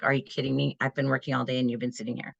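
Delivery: low, fast muttered inner gripe; exasperated complaint kept under the surface; inner exasperation. Acted. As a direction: grumble it low and quick, as private thought. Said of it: "even though inside I'm like".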